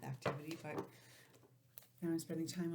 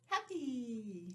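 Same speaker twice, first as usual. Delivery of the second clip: ordinary talk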